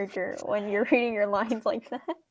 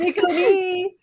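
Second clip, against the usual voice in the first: high singsong catchphrase; playful high-pitched chirp; lightly mimicked anime cutesiness; 6 semitones higher, slower than usual.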